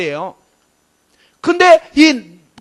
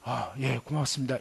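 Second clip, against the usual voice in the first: meek polite thanks; 12 semitones lower, 15 dB quieter than usual. Retold or imitated. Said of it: "그 말 듣고 어떻게 했냐면".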